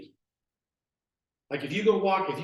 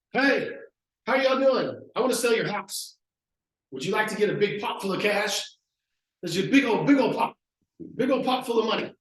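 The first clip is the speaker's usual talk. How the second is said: pitched up, loud, hammed-up salesman energy; eager door-knocker; 5 semitones higher, 5 dB louder than usual. Young agent knocking on doors, hyped and loud. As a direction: sell the big pot of cash like a pumped-up rookie.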